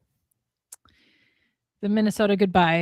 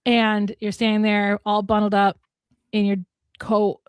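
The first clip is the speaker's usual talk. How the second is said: ordinary talk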